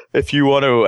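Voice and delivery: words stretched by a yawn. Real, not acted. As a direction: say it mid-yawn. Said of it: "as I yawn".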